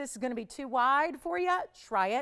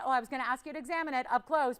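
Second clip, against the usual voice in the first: normal talking voice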